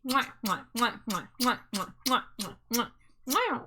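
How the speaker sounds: playful kiss sounds; smooch kisses. Pretend. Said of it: "Smooch to Wife ten pack".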